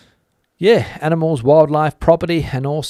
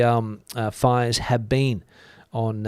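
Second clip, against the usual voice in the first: ordinary talk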